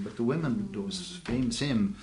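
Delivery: ordinary talk, nothing marked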